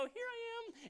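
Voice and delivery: put-on high, girlish sing-out; lifted, high-pitched announcement; giddy presentation. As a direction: pipe up high like presenting yourself proudly. Acts eager approach to God.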